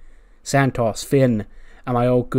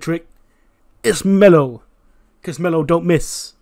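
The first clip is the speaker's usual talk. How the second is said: brash boastful trash talk, building louder; cocky Mello boast; 4 dB louder than usual. Retold or imitated. Mello boasting to Trick in the barber shop, cocky and loud. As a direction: strut and brag, ending loud.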